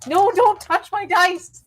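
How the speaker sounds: high, drawn-out possessive protest; alarmed protest. Real, not acted. Player stopping someone touching their dice, high urgent protest. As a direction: cry out to stop them.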